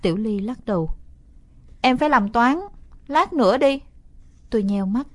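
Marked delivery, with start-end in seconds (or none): 1.8-3.8 little sister calling back. Acted. Sister calls back refusal.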